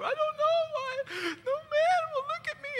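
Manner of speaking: high, wailing mock sob through the words; hammed-up weepy lament, slow and high; imitating a crying woman. Preacher imitating the lonely guest crying at dinner, high mock sob. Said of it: "she just kinda muffly cry".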